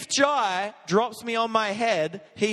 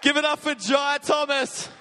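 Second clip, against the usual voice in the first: raised, higher announcer call; big showman shout-out; hype announcement; 8 semitones higher than usual. Real, not acted. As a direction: whip the church into applause. Cheering on the crowd after the flip.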